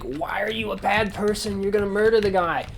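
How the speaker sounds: hammed-up, wounded protest; mock pleading. Son protesting his dad's killing, mock pleading.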